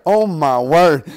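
loud, drawn-out mock astonishment; retold wide-eyed exclamation; mock amazement. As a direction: exclaim it slowly and loudly, playing amazed. Acting Joseph straining at the lever.

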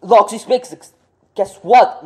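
normal talking voice

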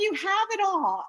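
high, loud pleading protest; mock desperate plea. Telling her coach he doesn't get it, high pleading protest. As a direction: whine it up high like a frustrated client.

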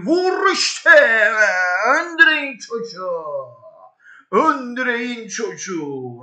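very high, loud screaming; real excitement, squealing; ecstatic shriek. Real, not acted. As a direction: shriek at the screen over the chance. Screaming at goal chance.

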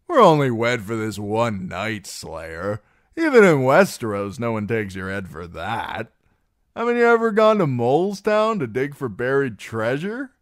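loud, tipsy scoffing; jovial drunk teasing a prude; dismissive drunken singer. Acted. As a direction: brush off the worry with boozy swagger. Plays Darion the drunken singer.

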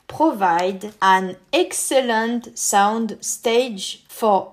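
slow, careful English with a French accent. Real, not acted. Presenter reads the pack description himself, slow French-accented English. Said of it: "désolé pour mon accent anglais".

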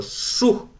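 high, voiceless whoosh; whooshing noise. A narrator's reading. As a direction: imitate something whistling past the ear. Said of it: "щось просвистіло повз Аннине вухо".

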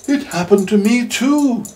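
high, slow ladybird voice. Acted. Ladybird joining in the tale, high and slow. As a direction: lift it high and draw it out. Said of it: "said the ladybird".

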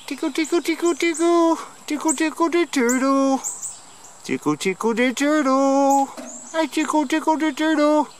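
put-on very high sing-song baby talk; squeaky high tickle talk to a turtle.

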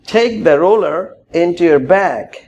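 tie your tie stretched out very slowly; slow model phrase. Shown as a demonstration. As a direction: draw out each word for learners. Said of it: "slow model phrase".

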